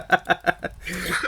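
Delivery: soft airy chuckle; a quiet laugh under the breath; breathy laugh. Real, not acted. Laughing after sarcastic complaint.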